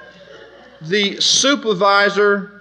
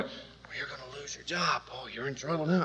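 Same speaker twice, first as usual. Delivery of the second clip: hushed, barely voiced warning; warning whisper; 4 semitones lower, 16 dB quieter than usual. Coworkers warning Paul he will lose his job, hushed. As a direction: lean in and whisper the danger.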